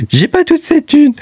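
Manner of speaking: very high, laughing exclamation; amused squeal. Real, not acted. YouTuber joking about money that isn't his, high amused squeal. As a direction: squeal it, cracking up in disbelief. Laughing at huge displayed balance.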